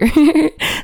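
brief high giggle; short laugh. Real, not acted. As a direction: let out a quick amused laugh. Laughs at own aside.

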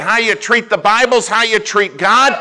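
high, full-voiced preaching shout; acted righteous indignation. Preacher quoting his pastor offended for God, indignant. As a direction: drive it home, loud and offended.

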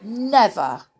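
loud refusal; defiant call. Acted. Mister Spells defying the giant. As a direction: call back firmly.

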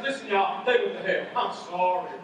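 low, grim preacher voice; a preacher breaking bad news; funeral preacher. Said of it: "the preacher stands up and says".

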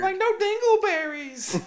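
high slow giggling jab; laughing mockery. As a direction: squeak it out mid-laugh. Mocking ad, laughing.

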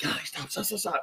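hushed, hurried plea to stop; retold urgent shushing. Parents straining to hear the baby breathe, urgent hushing. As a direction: hush her with rapid, whispery stops. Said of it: "and we're like".

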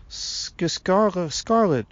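high-pitched, halting stammer; nervous stammer. A narrator's reading. Little girl telling the tree man her name, nervous stammer. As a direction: stumble shyly over your own name. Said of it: "nervously biting".

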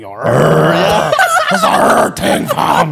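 loud raspy growl; rough loud rolled growl; loud Toronto rapper growl. Retold or imitated. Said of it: "it's [name] going".

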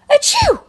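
big high sneeze; loud sneeze. A narrator's reading. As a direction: burst out a loud, high achoo. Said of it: "Bron sneezed".

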